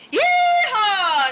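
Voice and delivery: high, whooping holler; cowgirl shout. A narrator's reading. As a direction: whoop it out long like at a rodeo. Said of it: "shouted Margaret".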